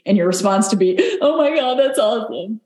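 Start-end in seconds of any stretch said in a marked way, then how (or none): 1.2-2.6 high, gushing fake delight, much higher pitch; mock gushing. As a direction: play it like an over-the-top thrilled reply. Mock gushing reply to praise.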